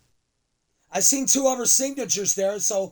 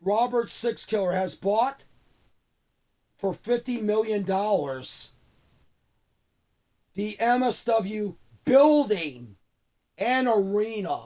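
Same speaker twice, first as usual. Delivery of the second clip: normal talking voice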